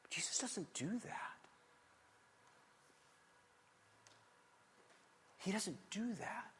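slow, near-voiceless softness; hushed. Real, not acted. Preacher hushing the congregation to make the point land. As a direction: drop to a slow, intimate hush.

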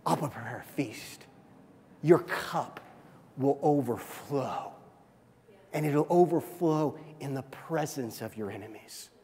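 soft God voice. Acted. Voicing God's promise softly.

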